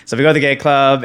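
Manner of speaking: normal talking voice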